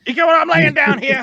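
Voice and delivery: loud, high put-on character voice; hammed-up echo. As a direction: belt it back in a jokey high voice. Echoes co-host in put-on voice.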